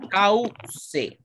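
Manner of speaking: "Cauce" with au as one syllable, pause, ce. Shown as a demonstration. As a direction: say "Cauce" in two separated beats. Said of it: "Ese es un diptongo".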